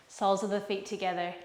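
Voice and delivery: normal talking voice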